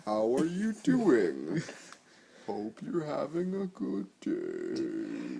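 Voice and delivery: dragged-out slowed-down greeting; slow half-speed voice. Pretend. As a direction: stretch each word like slowed tape. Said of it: "half speed".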